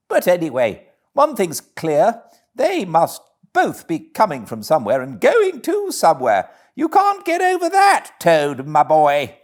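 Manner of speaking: high, loud, cocky little Toad voice; Toad talking to himself. Acted.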